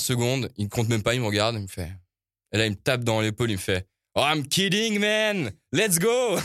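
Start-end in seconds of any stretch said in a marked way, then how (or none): 4.2-6.4 high-pitched mock American burst of glee; spring the joke, voice leaping up; imitating gleeful prankster. DJ slaps host's shoulder to reveal the prank, gleeful high imitation.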